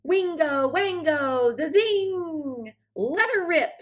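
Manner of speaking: high, loud whooping magic spell; shouted spell. Acted. The fairy godmother fires off her spell, high and loud. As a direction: hurl the spell out like a gunshot. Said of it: "shouted Cindy Ellen's fairy godmother".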